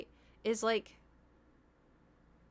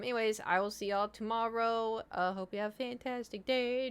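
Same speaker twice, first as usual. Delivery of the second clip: normal talking voice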